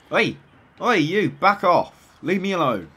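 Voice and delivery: loud, high shout to back off; yelling at enemies. Real, not acted. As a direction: yell at them to go away.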